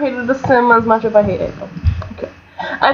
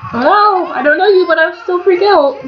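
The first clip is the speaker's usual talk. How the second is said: high, loud panicky mock voice; mocking the Sims; 8 semitones higher, 3 dB louder than usual. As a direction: squeal like a silly panicking crowd. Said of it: "Yep, there we go".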